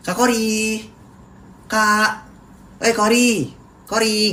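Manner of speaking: high, friendly calling of a name; polite greeting call. Retold or imitated. A polite stranger greeting Kori, high friendly call. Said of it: "Kalau manggilnya kayak gini".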